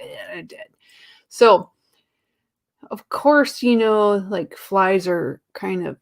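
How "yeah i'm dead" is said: dying fly. Retold or imitated. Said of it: "Then, like".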